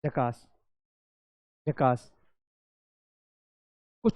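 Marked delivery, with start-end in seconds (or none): 0.1-2.2 झकास said low and falling, drawn out; low cheer word. Shown as a demonstration. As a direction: lead the class in a low झकास. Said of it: "झकास बोलो". (झकास नीचे और खिंचा हुआ)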